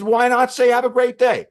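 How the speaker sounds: high, loud, hammed-up protest; indignant. Shoulder bug questions the receptionist's words, indignant. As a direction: demand it at the top of the voice.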